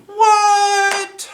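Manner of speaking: high, loud playful shock; hammed-up disbelief; mock outrage. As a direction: ham up the surprise for the kids.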